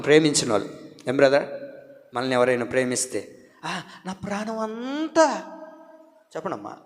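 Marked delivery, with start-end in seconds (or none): 3.6-5.4 soft, slow, laughing lover's coo; hammed-up lovey-dovey coo; mock sweet-talker. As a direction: tease how lovers sweet-talk. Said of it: "భలే మాట్లాడతారు".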